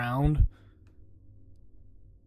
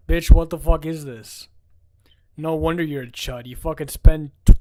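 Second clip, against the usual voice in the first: ordinary delivery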